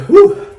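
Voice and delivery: high loud laughing woo; loud heat woo. Real, not acted. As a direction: yelp from the burn. Heat kicking in.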